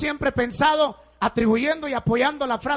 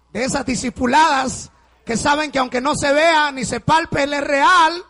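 louder, rising proclamation; preaching shout; 3 semitones higher, 4 dB louder than usual. Real, not acted. Preacher proclaims to the congregation, shouting. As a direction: cry it out with conviction.

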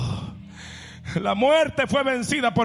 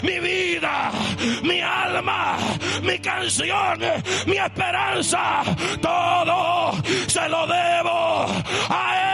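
preaching at full force; 9 semitones higher than usual. Acted. Pastor proclaiming to the congregation, preaching at full force. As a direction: preach it out to the whole church.

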